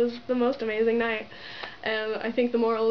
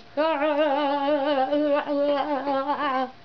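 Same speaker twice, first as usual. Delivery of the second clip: giddy laugh; 5 semitones higher, 4 dB louder than usual. Real, not acted. Laughing at herself.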